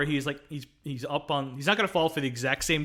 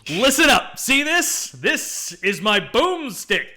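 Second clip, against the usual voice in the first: loud, high commanding proclamation; imitated action-hero bluster, drawn out at the end; hammed-up movie-hero speech; 8 semitones higher, 9 dB louder, slower than usual. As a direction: bellow at a crowd, then stretch the last words. Quotes hero addressing the crowd.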